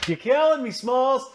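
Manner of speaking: high, lightly voiced quote from The Sandlot; movie quote impression. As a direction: tease a friend with the famous line. Movie quote aimed at co-host.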